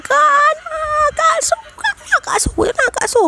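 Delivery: stretched-out falsetto cry; retold crying scream. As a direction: imitate wailing for big sister, held-back volume. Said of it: "Aku dah jerit-jerit macam tu".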